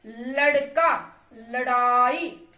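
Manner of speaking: लड़का and लड़ाई drawn out with the ड़ flapped; teacher demo of ड़ words; slow loud demo. Shown as a demonstration. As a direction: say लड़का, लड़ाई slowly for pupils. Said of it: "ये इनका अंतर है".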